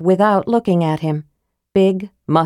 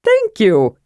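high, delighted thanks; joyous; 4 semitones higher than usual. A narrator's reading. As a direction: burst out with childlike joy. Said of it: "said Tasselhoff joyously".

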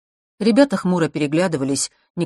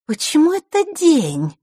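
put-on very high, louder girlish singsong; 12 semitones higher, 5 dB louder than usual. A narrator's reading. Girl butts in on a complaint, singsong. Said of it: "нараспев произнесла Маришка".

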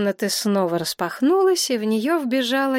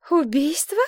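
high, lost questioning echo; disbelieving high query; bewildered; 8 semitones higher than usual. A narrator's reading. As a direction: echo the word in confusion, pitch rising. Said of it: "растерянно переспросила Елена".

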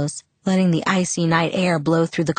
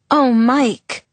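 startled gasp, higher and louder; 7 semitones higher, 5 dB louder than usual. Acted. Bella seeing sick Mike stumble out, gasping. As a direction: gasp out his name in alarm. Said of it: "I gasped".

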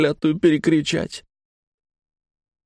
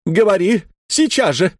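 shouting; 7 semitones higher than usual. Retold or imitated. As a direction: demand it at once. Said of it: "кричит жена".

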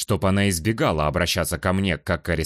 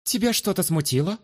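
high, pressing challenge; pushy; 11 semitones higher than usual. Acted. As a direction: press him assertively. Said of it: "сменила тон ... на куда более напористый".

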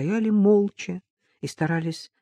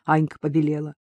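usual speaking voice

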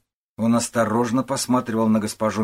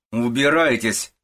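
loud, drawn-out dismissal; angry shout; 4 semitones higher, 5 dB louder, slower than usual. A narrator's reading. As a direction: shout to send them away. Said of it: "кричит госпожа Мюршель". (гневный крик)

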